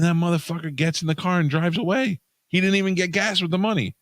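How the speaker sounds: high-pitched, incredulous outrage; indignant. Real, not acted. Storyteller outraged at being duped at a gas station, indignant.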